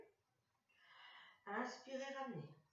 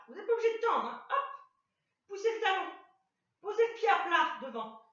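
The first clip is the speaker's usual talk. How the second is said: higher, louder instructions; loud coaching call; 7 semitones higher, 7 dB louder, faster than usual. Real, not acted. Coach calls to the class during the lift, louder and higher. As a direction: call out to the class mid-move.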